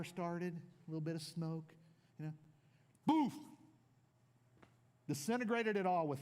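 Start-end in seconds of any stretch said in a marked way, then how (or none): 3.0-3.3 boof explosion. Retold or imitated. Fire explosion sound effect.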